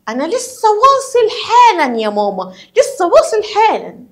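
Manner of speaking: high-pitched acted kid voice; child to mother. Child telling mama they have just arrived, in a high acted voice. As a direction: play a child calling to mum, pitched high.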